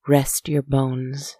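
usual speaking voice

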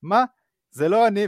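put-on caught kid protesting. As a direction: play the busted five-year-old denying it.

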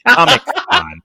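"Comic Con" squeaked very high and loud; squeaky high voice. Pretend. Silly high squeal of the name.

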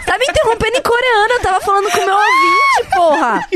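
high, laughing fake indignation; mock outrage. Host complains of being cut off, laughing in mock outrage.

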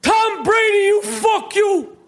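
high-pitched, drawn-out furious shouting; hammed-up enraged team boss tirade; Danny Aiello. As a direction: chew someone out in a slow, high yell. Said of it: "If Danny Aiello was, uh, owned the Patriots, he'd be saying this".

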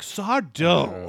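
put-on hammy magician accent; Egyptian accent. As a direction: Sardo with a stagey accent. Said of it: "accent on the dough".

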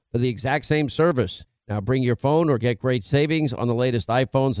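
low, even radio ad read. Acted. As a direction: read the ad smooth and steady like a radio pitchman. Host reads sponsor ads.

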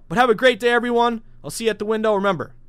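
loud, high upbeat farewell; hyped sign-off. Real, not acted. Host signing off to his listeners, hyped and loud. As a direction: pump up the listeners on the way out.

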